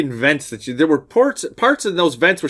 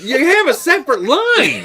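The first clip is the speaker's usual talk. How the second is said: high-pitched exasperated protest; mock disbelief; 9 semitones higher, 4 dB louder than usual. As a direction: point out the absurdity, amused and incredulous. Mocking the surprised phone answer.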